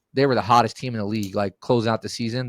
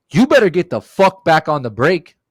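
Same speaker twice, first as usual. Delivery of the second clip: louder, higher angry order; mock yell, pitched up; retold yelling; 5 semitones higher, 6 dB louder than usual. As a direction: yell it as an imitation of an angry teammate. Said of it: "starts yelling at me".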